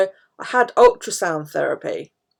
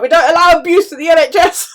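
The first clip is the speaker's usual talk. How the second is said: higher, louder mock-stern official; physio voice; 10 semitones higher, 10 dB louder than usual. Physio scolding her for swearing, mock stern. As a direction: lightly play the stern physio. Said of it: "And he's like".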